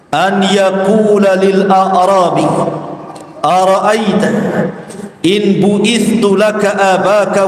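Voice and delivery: slow, measured recital with drawn-out syllables; Arabic hadith recitation. Acted. Preacher quoting a long hadith to listeners, solemn Arabic recitation. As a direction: recite it slowly, stretching the long vowels.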